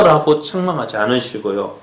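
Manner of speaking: usual speaking voice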